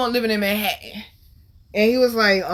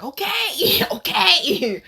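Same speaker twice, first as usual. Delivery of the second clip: high-pitched, laughing mock-meek okay; compliant; 9 semitones higher, much slower than usual. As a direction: squeak your agreement like someone told off. Giving in to friend's orders.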